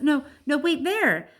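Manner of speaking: high, loud excited yell. Acted. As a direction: yell it out in a rush. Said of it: "Ruby yells".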